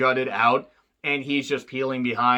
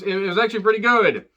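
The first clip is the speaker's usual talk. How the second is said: normal talking voice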